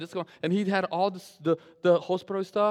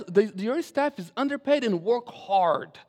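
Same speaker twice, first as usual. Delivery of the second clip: normal talking voice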